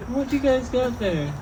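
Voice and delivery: high-pitched, soft stoner nosiness; a little high. Retold or imitated. Stoned comic mimicking himself eyeing diners' food, a little high. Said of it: "I'm a little high".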